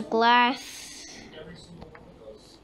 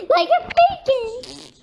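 high laughing squeal; 12 semitones higher, 7 dB louder, slower than usual. Pretend. Repeats it in a squeal.

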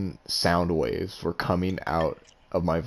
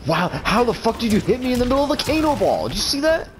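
can't believe it, yelling; outraged disbelief. Real, not acted. Outraged reaction to a hit.

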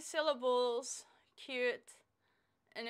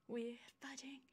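spent, whispered curse; soft whispered swear in relief; breathless whisper; 13 dB quieter than usual. Real, not acted. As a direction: whisper it, drained after the plank. Exhausted after the plank.

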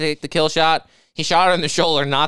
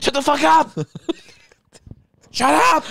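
mock whiny yell; 10 semitones higher than usual. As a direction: scream it like a spoiled kid. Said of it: "He's like".

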